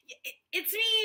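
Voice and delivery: high, loud, put-on squeaky "it's me"; mock giddy reply. Answering her name being called, in a high giddy mock voice. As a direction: play it up like a startled winner.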